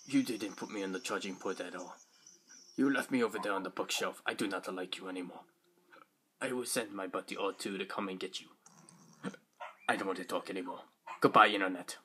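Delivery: low, quiet sulking robot character. Acted. Toy robot snubbing its owner, low sulky voice. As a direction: refuse in a soft, low put-on accent. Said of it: "I picture the B B eight voice like this".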